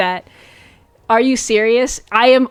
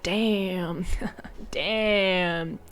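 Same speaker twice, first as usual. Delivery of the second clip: hushed damn; 3 semitones lower, 10 dB quieter than usual. Retold or imitated. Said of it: "he's like".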